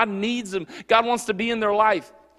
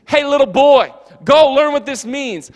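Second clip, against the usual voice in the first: put-on scolding a child; 6 semitones higher, 8 dB louder than usual. Jesus scolding a biblical scholar as if he were a little boy.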